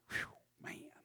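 breathy whispered whew of awe. Real, not acted. Preacher marvels at his own blunt point in a whisper. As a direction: blow it out like the point hit hard. Awed exhale after bold claim.

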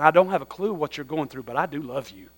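sit beside them and say it softly; tender comfort. Acted. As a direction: friend arm round someone hurting, tenderly.

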